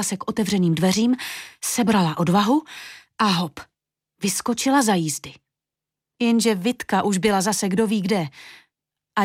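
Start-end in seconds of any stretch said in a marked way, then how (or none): none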